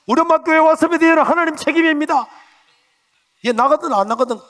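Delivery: high, loud desperate plea; fervent prayer. Retold or imitated. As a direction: cry out to God. Desperate prayer carrying mother. (간절하게 외치는 기도)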